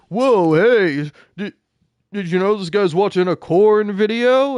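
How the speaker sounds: a hint of a loud, eager guy blurting news; mock excited exclaim. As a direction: imitate a guy excitedly tattling. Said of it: "hearing some guy very excitedly exclaim".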